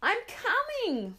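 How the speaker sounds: high, slow, loud cry back; trapped girl answering her mother; screamed reply. Acted. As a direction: scream it through the door. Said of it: "Mona screamed back".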